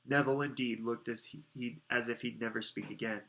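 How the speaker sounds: usual speaking voice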